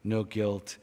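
ordinary delivery

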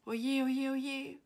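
loud, high-pitched lament; acted loud whining; wailing dragon. A narrator's reading. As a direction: wail in dismay as a little storybook dragon. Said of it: "hörte Philipp ihn jammern".

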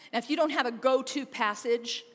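ordinary delivery

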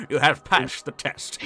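put-on slow, quavering old man voice; whiny nasal old person. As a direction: drag it out like an ancient sage. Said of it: "in an old man voice".

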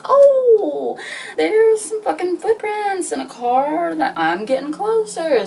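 high, drawn-out mock wonder; hammed-up tipsy delight; acted excited discovery. Drunk self spotting signs on path.

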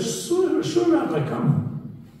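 very high-pitched, condescending consent; mock indulgence. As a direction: humor him in a squeaky high voice.

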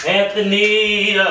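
drawn-out exasperated call across the room. Real, not acted. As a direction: call him out, dragging the name. Calling ignoring boyfriend louder.

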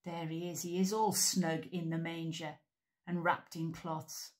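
ordinary delivery, nothing marked

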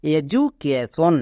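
"এডুকেশন" said slowly as e-ju-ke-shon; j sound for d. Shown as a demonstration. Teacher sums up the pronunciation, "এডুকেশন" slowly as e-ju-ke-shon. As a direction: draw out "এডুকেশন" syllable by syllable, j in place of d. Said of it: "তাহলে এর উচ্চারণ কি দাঁড়ালো".

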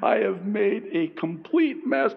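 high, exasperated wail to God; throwing up hands. Acted. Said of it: "you throw up your hands".

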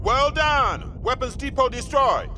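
triumphant shout. Acted. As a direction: bellow the victory across the field. Shouting over battle.

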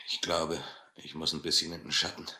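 faint, breathless near-whisper; fading strength, barely voiced; weak whisper. The blind captain weakens in the heat, whispering.

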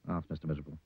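ordinary delivery

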